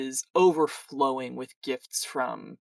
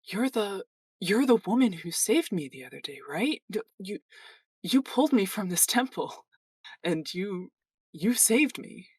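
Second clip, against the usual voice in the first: higher-pitched, earnest temple worker; very sincere young man; 6 semitones higher than usual. Acted. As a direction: speak with halting, sincere gratitude. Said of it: "in a very sincere voice".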